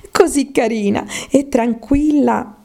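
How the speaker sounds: high, cooing praise of a pet; Alice, fondly musing. Acted.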